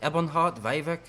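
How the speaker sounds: lightly voiced Irish-accented scout. Scout Alone offers a traveler directions, put-on Irish accent. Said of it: "why you suddenly have an Irish accent".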